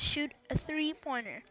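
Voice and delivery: usual speaking voice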